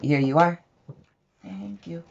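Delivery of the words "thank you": high, soft thank-you; modelled thank-you. Shown as a demonstration. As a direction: prompt a toddler softly in a high voice.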